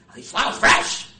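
loud, high mock bark; hammed-up snappy shopper; barking customer. Women barking at the florist, loud and high. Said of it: "barking at him".

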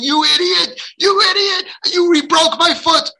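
very high, loud acted scream; screaming in pain. Child with broken leg at the parent, acted furious scream. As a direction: play a hurt child yelling at a parent. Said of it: "and now they're screaming".

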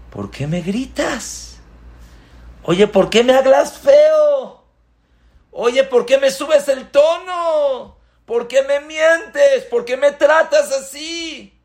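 mock wounded outrage; offended complainer. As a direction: protest being yelled at. Hurt person complaining.

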